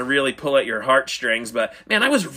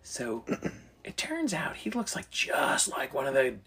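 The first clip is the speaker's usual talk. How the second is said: 1 hushed gossip; 10 dB quieter than usual. Real, not acted.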